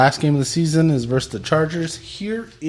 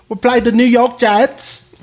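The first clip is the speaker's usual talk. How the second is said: high, loud cockney version of the line; London accent; 9 semitones higher, 6 dB louder than usual. Shown as a demonstration. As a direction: say it again as a Londoner. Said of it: "Can you do that in a London accent?".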